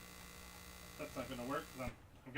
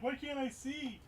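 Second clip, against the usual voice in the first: high confused voice; 11 semitones higher, slower than usual. Retold or imitated. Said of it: "I'm like".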